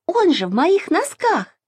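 high-pitched indignant protest; crying out. A narrator's reading. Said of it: "закричала Маша". (возмущённо, высоким голосом)

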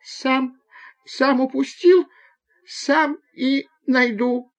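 put-on high, slow, tearful child's voice; whimpering boy. A narrator's reading. Borya sobbing under the bench, whimpering. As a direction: whimper it stubbornly, high and drawn out. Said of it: "Боря всхлипнул под лавкой".

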